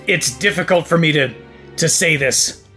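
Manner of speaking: normal talking voice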